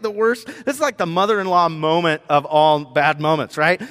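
ordinary delivery

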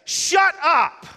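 very high, very loud startled shout; shouted shut up. Retold or imitated. Wife shouting in the final session, a loud, high startled shout. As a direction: blurt it out at full volume in shock. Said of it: "loud enough, for everybody in Yakima to hear".

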